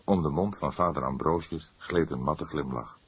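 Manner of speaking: ordinary delivery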